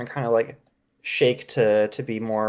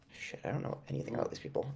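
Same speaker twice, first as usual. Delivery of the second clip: quick aside whisper; 4 semitones lower, 14 dB quieter, much faster than usual. Real, not acted. Player aside, out of character.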